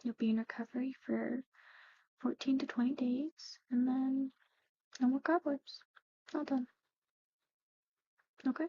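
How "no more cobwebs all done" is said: usual speaking voice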